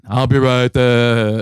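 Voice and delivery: tinny far-off voice through a door buzzer; distant voice over the buzzer; over the intercom. Retold or imitated. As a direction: answer like a muffled intercom. Dave answering over the buzzer.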